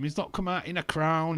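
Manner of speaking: ordinary talk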